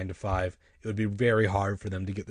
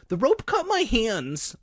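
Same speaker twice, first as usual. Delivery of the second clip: high, slow whine; pained explaining; 13 semitones higher, slower than usual. A narrator's reading. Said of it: "I explained".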